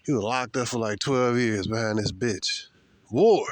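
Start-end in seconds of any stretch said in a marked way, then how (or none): 3.1-3.4 forceful "War.". Real, not acted. Preaching to his listeners while walking, loud single-word "War.". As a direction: bark out "War." louder.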